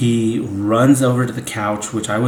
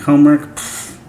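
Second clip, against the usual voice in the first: dismissive scoff; 5 semitones higher, 5 dB louder than usual. Retold or imitated. Scoffing at homework.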